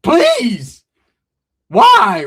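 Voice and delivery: hammed-up, high, loud wail; mock anguish. Rejected teller pleading, loud mock crying. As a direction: wail it in comic despair. Mock heartbreak over girl's choice.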